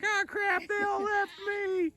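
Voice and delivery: lightly voiced panicked goat, high; mock goat dismay in a high voice; voicing the goat. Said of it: "she's like".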